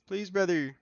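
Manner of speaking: high, loud mock begging; mock pleading. Streamer begging viewers for help, loud mock pleading.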